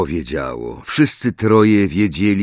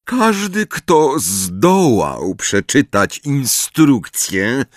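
slow, heavy emphasis; emphatic; 7 semitones higher, 3 dB louder, much slower than usual. Acted. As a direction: lean on every word. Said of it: "oznajmił z naciskiem Snape".

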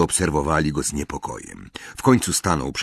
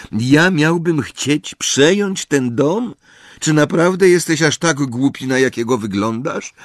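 higher, louder scoffing voice; incredulous mockery; 9 semitones higher, 4 dB louder than usual. A narrator's reading. Harry mocking his uncle's theory. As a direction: scoff in disbelief.

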